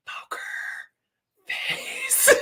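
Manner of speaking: a hushed aside, as if leaning in to a client; whispered warning. Retold or imitated. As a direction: breathe it quietly, barely voiced. Attorney leaning over in court.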